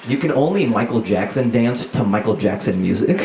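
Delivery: ordinary delivery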